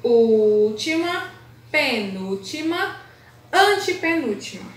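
Última, penúltima, antepenúltima very slowly, one by one; emphatic listing. Shown as a demonstration. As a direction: hold each term out as if counting for pupils. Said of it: "Tem que olhar sempre de trás pra frente". (contagem lenta, termo por termo)

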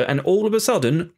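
ordinary talk

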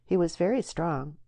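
plain reading voice

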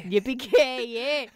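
slow drawn-out Scottish Yippee-ki-yay; hammed-up brogue take; bad Scottish accent. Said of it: "give me something else to say".

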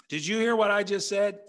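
mock-stern parent catching a kid not listening; exasperated parent. Parent scolding kids.

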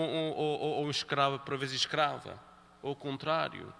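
ordinary talk, nothing marked

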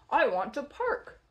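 usual speaking voice

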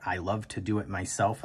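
ordinary talk, nothing marked

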